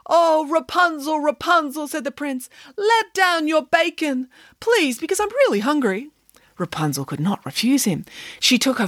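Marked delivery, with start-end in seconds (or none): 0.1-6.1 high, lovestruck begging prince; pleading prince. Acted. Hungry prince begging Rapunzel below tower, high pleading. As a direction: swoon and beg for bacon.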